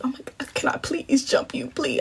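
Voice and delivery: whispered, polite begging; hushed mock-polite plea; kind pleading. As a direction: beg softly to cut in, mostly whispered. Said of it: "I was being so kind".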